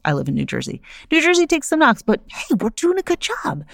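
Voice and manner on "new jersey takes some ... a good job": high, proud pep-rally voice; rally the neighbors, playfully; lightly hammed-up hometown pride; mock cheer. Cheering on her home state.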